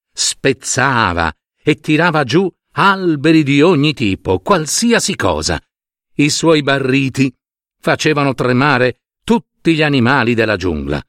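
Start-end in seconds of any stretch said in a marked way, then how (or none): none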